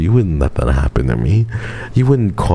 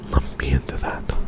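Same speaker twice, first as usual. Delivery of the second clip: hushed mock-threatening whisper; 3 semitones lower, 8 dB quieter than usual. Shown as a demonstration. Streamer whispers a playful warning to a chatter. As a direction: breathe the warning with no voice. Repeats the line, then whispers it.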